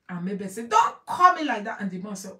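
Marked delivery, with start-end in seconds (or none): 0.7-1.8 sharp, higher, louder snap back; indignant retort. Retold or imitated. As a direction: quote her offended protest. Quoting woman's angry reply.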